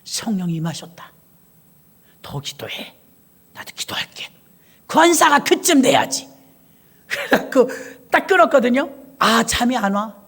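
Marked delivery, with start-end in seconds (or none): 0.0-4.3 low, whispered blessing; softly whispered prayer words; hushed whisper. Retold or imitated. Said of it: "권사님이 그러는 거야". 4.9-6.3 proud boast. Retold or imitated. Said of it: "권사님이 그러는 거야".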